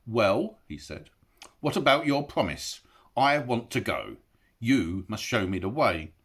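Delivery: lightly voiced hobbit, demanding his promise; a hint of an impatient hobbit; Bilbo, firm. A narrator's reading. Bilbo pressing Gollum to keep his promise, firm.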